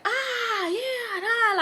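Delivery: high, drawn-out imitated hollering; turned-up chatter. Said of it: "like, a real turned up conversation".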